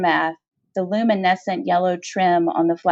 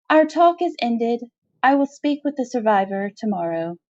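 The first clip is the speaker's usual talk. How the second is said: higher, final alien queen; regal dismissal; 6 semitones higher than usual. Acted. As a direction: close the audience with cool authority.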